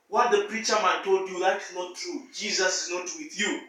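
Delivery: low, hushed voice of Satan; murmured doubt in a sleeper's ear; whispered temptation. Acted. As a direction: drop right down, very soft and insinuating. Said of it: "Satan came and whispered".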